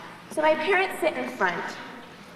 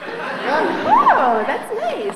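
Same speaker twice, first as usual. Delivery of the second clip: louder oh, high swooping "that's nice"; fake enthusiasm; 7 semitones higher, 6 dB louder, much slower than usual. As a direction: gush without meaning it. Condescending to roommate.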